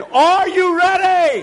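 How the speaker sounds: high, slow, stretched-out shout; rousing shout. Real, not acted. Preacher asks the congregation again, high rousing shout. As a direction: whip the crowd up with a drawn-out yell. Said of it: "Let me try that again".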